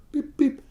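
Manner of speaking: high, squeaky scanner beeps; mock scanner beep, high; register beeps.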